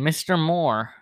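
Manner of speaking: ordinary delivery, nothing marked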